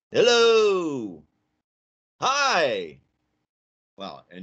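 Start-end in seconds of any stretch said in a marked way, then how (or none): 0.1-3.0 high, loud, drawn-out call; loud demo. Shown as a demonstration. Teacher testing the salt drum with his voice, loud. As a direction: holler each greeting long and high. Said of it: "increase the intensity of my voice".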